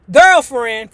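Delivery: usual speaking voice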